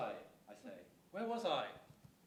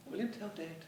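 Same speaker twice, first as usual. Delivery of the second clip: normal talking voice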